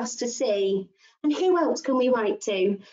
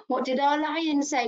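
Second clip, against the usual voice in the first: ordinary talk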